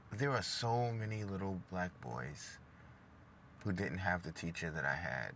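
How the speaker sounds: quiet, slowed, emotional reflection. Real, not acted. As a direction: hold back the feelings as you remember. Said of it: "I'm a little emotional".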